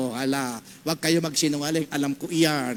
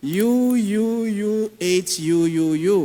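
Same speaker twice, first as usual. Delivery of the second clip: loud drawn-out mock sobbing; imitating a sobbing teen; crying sound; 2 semitones higher, 6 dB louder, slower than usual. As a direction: wail it like a crying kid. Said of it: "Lagbigyan mo nga ng sound".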